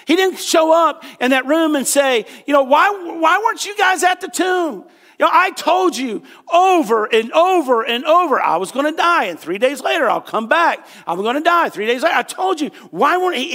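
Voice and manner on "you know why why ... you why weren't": high-pitched mock scolding; hammed-up indignant telling-off; lecturing. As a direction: berate them in acted exasperation. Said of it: "he didn't come there to lecture".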